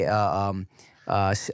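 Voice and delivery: ordinary talk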